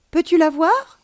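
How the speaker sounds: put-on high, loud childlike question; astonished question. A narrator's reading. Child asks alien about seeing grandmother, astonished.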